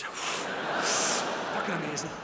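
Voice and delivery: long sigh, words dragged out slowly; sigh then slow. Retold or imitated. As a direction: sigh it out like a dazed stoner, very slow. Retelling stoned friend's pill story.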